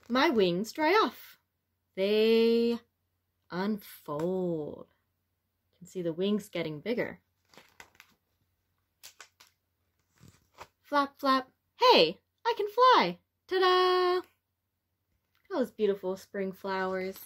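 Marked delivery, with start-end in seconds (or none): 0.1-11.5 lively, expressive picture-book read-aloud; reading to children. A narrator's reading. 11.8-14.2 much higher, louder cheer; thrilled butterfly ta-da; excited triumph. Acted.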